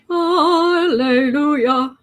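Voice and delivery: slow, loud sung-out hallelujah; joyful mock praise, long and loud; drawn-out hallelujah. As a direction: stretch it like a gospel shout. Celebrating nine more days.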